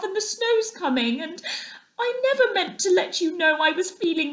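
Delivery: high, small, trembling voice; a little animal pleading through tears; tearful Mole. A narrator's reading.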